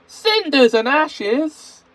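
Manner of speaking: high, slow, loud cry of shock; a startled engine yelps; exclaiming. Acted. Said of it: "he exclaimed".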